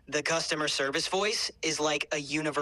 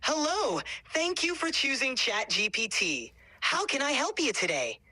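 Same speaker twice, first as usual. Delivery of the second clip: much higher, bright cheery service greeting; cheery customer service voice; 11 semitones higher than usual. Shown as a demonstration. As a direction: sing it out like a happy shop clerk. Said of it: "try making it a little bit more, like, cheery".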